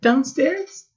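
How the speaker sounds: put-on high, unsure little boy; a nervous boy asking; timid child. Laszlo asking the dark where, high and timid.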